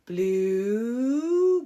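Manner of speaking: long, rising, loud call of the name; drawn-out call-out. Real, not acted. Vlogger calling out Blue on the show, drawn-out and loud. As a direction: stretch the name out to call her out.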